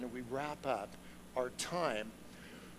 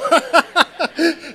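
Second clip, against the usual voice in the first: loud burst of laughter, higher; big laugh; 11 semitones higher, 14 dB louder than usual. Real, not acted. Laughing out loud as he retells the walkie-talkie call. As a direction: break into a real, loud laugh.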